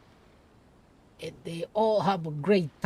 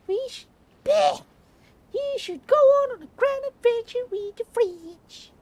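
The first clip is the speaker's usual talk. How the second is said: Klee voiced way higher in a southern twang; southern accent. Reading Klee's line aloud, high southern accent. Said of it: "Why did I have an ac-, a southern accent?".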